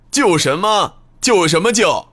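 high, ringing scoff; loud jeering call. Acted. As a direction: call out mockingly from afar. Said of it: "一个响亮的声音".

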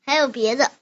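usual speaking voice

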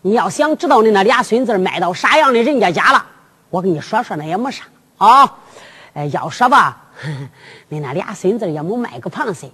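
plain reading voice

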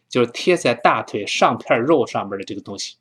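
normal talking voice

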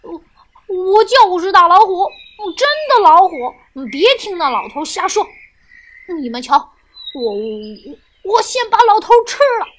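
loud, blustering tiger, stumbling over the first word; fierce, savage. A narrator's reading. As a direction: snarl back furiously, then threaten. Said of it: "凶狠狠地说".

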